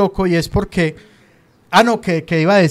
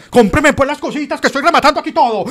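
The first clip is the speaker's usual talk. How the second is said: higher, fast hawker's paisa patter; paisa street seller patter; 8 semitones higher, 5 dB louder than usual. Retold or imitated. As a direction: rattle it off like a market vendor pushing his wares in paisa. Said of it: "Hay que hablar es como este man". (vendedor paisa, agudo y rapidísimo)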